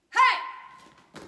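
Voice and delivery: loud high shout on the jump; kiai shout. Shown as a demonstration. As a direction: shout it as you spin.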